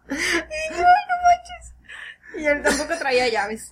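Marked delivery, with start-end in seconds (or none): 0.7-1.7 very high, loud wail through laughter; laughing despair. Retold or imitated. Realizing nobody has keys, high laughing wail. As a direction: whine it while crying with laughter.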